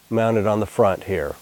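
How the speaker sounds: ordinary delivery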